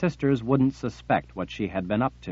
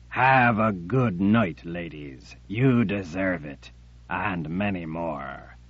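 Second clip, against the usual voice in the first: mocking menace; 2 semitones lower, slower than usual. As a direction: drawl it with cruel mockery. Jagang grins like death.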